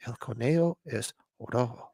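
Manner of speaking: very soft, hushed Spanish quote; imitated whisper. Host showing how he whispered it, hushed. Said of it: "like, whispered it".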